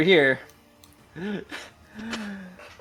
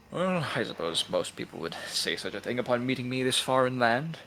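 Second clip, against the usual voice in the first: lower, softer regal dragon; deep muffled sea monster; 5 semitones lower, 9 dB quieter than usual. Acted. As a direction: speak low and lofty. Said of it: "great dragon of the seas".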